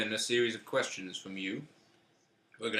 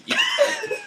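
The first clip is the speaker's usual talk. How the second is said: squeaky voice; 6 dB louder than usual. Acted. Sudden high squeak.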